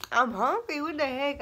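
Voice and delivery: loud, high-pitched protest; indignant retort. Retold or imitated. Retort to sister's teasing.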